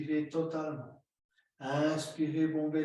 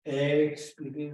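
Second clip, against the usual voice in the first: Expirez stretched slow and louder; drawn-out cue; 6 dB louder than usual. Shown as a demonstration. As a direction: push the exhale out with a long Expirez. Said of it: "cueing a long exhale". (Expirez appuyé et allongé)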